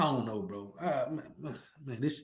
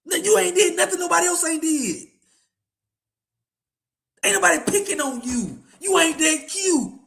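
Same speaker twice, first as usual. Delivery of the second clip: high, emphatic, needling jeer; taunting put-down; 6 semitones higher than usual. Real, not acted. Host telling thin-skinned critics nobody is picking on them, high taunting put-down. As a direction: rub it in, pitched up high.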